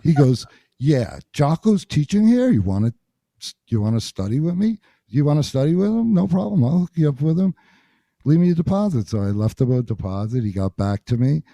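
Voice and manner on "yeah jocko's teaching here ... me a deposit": higher, lively, upbeat delivery; eager offer. Retold or imitated. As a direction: pitch it up, keen to help. Castellanos offering to help, retold.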